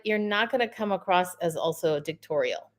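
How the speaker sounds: ordinary delivery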